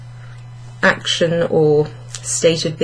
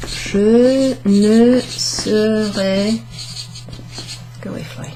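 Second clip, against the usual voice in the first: slow word by word; 3 semitones higher, much slower than usual. Shown as a demonstration. Teacher reading the riddle to students, very slow. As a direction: spell it out one word at a time, very slow. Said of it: "this is kinda hard".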